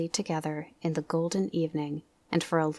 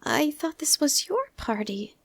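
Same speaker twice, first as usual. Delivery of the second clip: Piglet's voice; 10 semitones higher than usual. Acted. Piglet speaks to Pooh.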